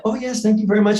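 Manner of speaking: a grateful German-sounding jogger, voiced high; German-ish accent. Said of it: "who had the accent".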